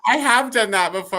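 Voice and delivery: ordinary delivery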